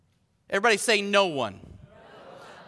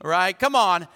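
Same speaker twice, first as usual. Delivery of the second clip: ordinary talk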